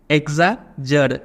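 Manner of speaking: "एग्जाजर" slowly, broken into syllables; pronunciation demo. Shown as a demonstration. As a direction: sound out "एग्जाजर" piece by piece for learners. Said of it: "इसको pronounce कैसे करेंगे".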